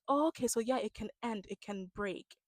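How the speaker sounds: imitated gentle voice, fading very soft; mock soft-spoken. Imitating Binda predicting a breakup, soft-spoken. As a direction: let it drop away in false sweetness. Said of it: "they talk so soft".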